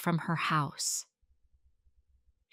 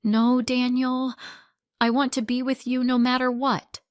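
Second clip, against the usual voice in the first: high, loud, fast plea; crying out; 6 semitones higher, 7 dB louder than usual. A narrator's reading. Sarah pleading with Daniel, crying out loud. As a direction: cry out desperately to him. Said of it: "Sarah cried".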